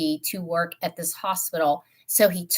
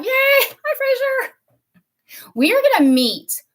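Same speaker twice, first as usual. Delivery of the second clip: high, delighted squeal of hello; very high-pitched, happy welcome; excited greeting; 6 dB louder than usual. Real, not acted. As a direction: cheer as a friend turns up. Greeting a friend joining live.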